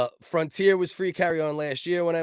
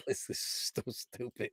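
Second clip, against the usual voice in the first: whispered; 5 semitones lower, 14 dB quieter than usual. Real, not acted. Aside, unvoiced.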